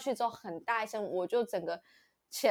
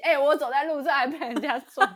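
louder, aggrieved complaint; imitating angry auntie; 5 semitones higher, 10 dB louder than usual. Imitating the auntie complaining about being hit, louder and aggrieved. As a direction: grumble as the wronged auntie. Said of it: "那阿姨表示生气".

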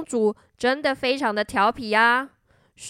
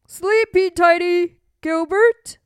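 loud, higher, playful bedtime call; sing-song goodnight; 5 semitones higher, 8 dB louder than usual. Acted. King saying goodnight to Gilbert, playfully. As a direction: chirp a goodnight for a child.

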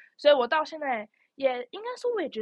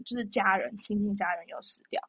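ordinary delivery

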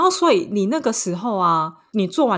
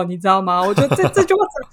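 usual speaking voice

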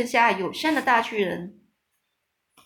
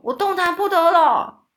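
much higher, loud giant cry; trapped giant crying out loudly; 9 semitones higher, 7 dB louder than usual. A narrator's reading. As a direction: yell it out, pitched way up. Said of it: "大声说".

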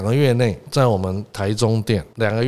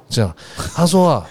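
usual speaking voice

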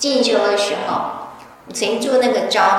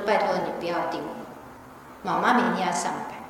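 soft pleading; 5 semitones lower, 11 dB quieter than usual. Retold or imitated. Softly pleading with a mosquito.

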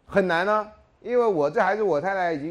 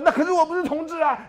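high, loud flustered protest; panicked denial; 14 semitones higher, 7 dB louder than usual. Retold or imitated. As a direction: blurt it out in a panic. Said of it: "然后说".

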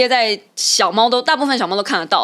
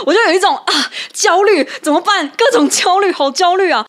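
anxious; 9 semitones higher, 4 dB louder than usual. Real, not acted. Host reliving her panic over the member, anxious wail. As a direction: panic out loud, what do I do.

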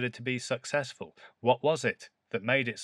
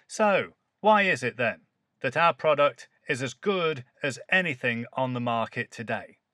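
rhetorical question; 3 semitones higher, 5 dB louder than usual. Shown as a demonstration. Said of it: "start off with a rhetorical question".

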